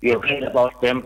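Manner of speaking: ordinary talk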